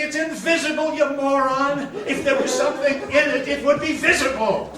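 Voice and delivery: loud, contemptuous retort with a laugh; exasperated outburst. Retold or imitated. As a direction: tell the moron off at full volume. Victor snaps at the leader.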